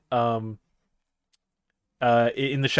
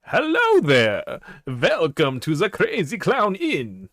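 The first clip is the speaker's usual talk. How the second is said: slow, high, drawn-out Transylvanian-style host voice; campy Dracula innkeeper accent; vampire innkeeper accent; 5 semitones higher, slower than usual. Acted. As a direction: greet them like a creepy Count. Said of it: "Do you really talk like that".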